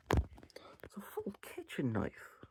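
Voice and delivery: slow, high breathy whisper of disbelief; awed whisper. Real, not acted. Reviewer stressing the knife's size, slow whisper. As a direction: hush it like it's horrifying. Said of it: "stressed retelling".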